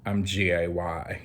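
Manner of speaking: hesitant retold confession, spelled letter by letter; spelling it out. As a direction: spell out G A Y instead of saying the word. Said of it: "all I could do was spell it".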